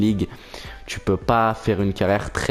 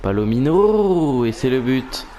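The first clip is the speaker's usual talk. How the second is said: excited goal call; 3 semitones higher, slower than usual. Acted. Building commentary to a goal.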